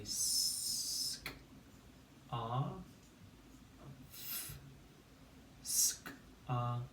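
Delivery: "s-, c-, r, f-" as isolated sounds with long pauses; sounding out. Shown as a demonstration. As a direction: stretch and separate each sound of "scarf". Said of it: "And we say".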